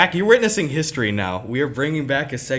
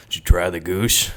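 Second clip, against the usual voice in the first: low uncle voice; family uncle; 7 semitones lower than usual. Retold or imitated. Head-of-family uncle checking everyone tried his goose, low imitation. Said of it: "the kinda head of the family uncle".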